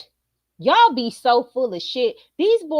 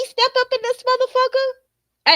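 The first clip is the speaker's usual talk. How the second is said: excited; 13 semitones higher than usual. Acted. Building to daddy's entrance.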